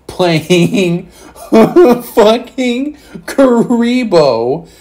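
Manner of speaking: high, drawn-out words broken by a laugh; laughing in disbelief. Real, not acted. Telling viewers about the absurd winning deck, laughing incredulously.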